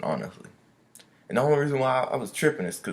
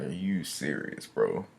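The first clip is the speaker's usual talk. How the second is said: low, soft, slow and deadpan; disbelieving; 7 semitones lower, 6 dB quieter, slower than usual. Retold or imitated. Muttering to himself over the wasted hour, low and slow. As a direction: let the disbelief sink in slowly.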